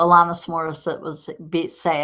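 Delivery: ordinary talk, nothing marked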